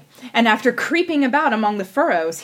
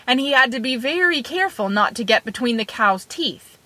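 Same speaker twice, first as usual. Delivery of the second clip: plain reading voice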